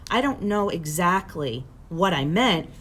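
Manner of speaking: ordinary delivery, nothing marked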